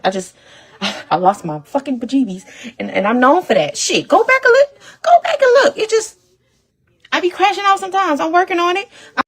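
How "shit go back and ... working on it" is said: high, tearful crying voice, much higher; real crying, voice going up in sobs; words pushed out through tears; breaking down crying. Real, not acted. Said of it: "She said she lost her bejeebies".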